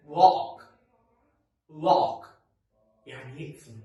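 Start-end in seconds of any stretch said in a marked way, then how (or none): 0.1-2.3 Lock said loud with an open ah-like o. Shown as a demonstration. Teacher modelling lock for the class. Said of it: "So I would say what".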